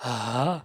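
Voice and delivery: ہا low and breathy from the throat; deep guttural ہا demo; throat sound. Shown as a demonstration. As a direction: push out ہا from deep down. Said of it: "تو ہا کی آواز".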